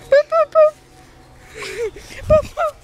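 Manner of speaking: high, loud sing-song boops; squeaky playful boop boop. Real, not acted. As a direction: boop him like a game. Playful booping the child.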